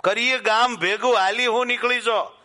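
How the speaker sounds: bold challenge shouted at full force; manly shout. Acted. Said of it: "અને મર્દાનગીમાં કહ્યું કે". (મર્દાનગીભર્યો ઊંચો પડકાર)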